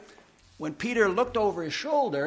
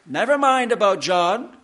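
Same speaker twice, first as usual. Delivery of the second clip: loud, higher, firm dismissal; emphatic command; 4 semitones higher, 7 dB louder than usual. Retold or imitated. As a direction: brush the question aside forcefully. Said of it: "It's very emphatic".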